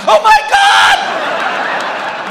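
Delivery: very high, drawn-out mock scream; panicked scream. Comic retells his reaction to the freaked-out attendant, screaming in panic. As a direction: shriek it in hammed-up terror.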